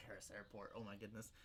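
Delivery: normal talking voice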